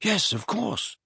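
high, soft, mildly puzzled; slightly nonplussed. A narrator's reading. Boy answering his mentor, slightly nonplussed. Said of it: "slightly nonplussed".

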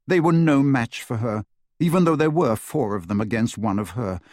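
plain reading voice

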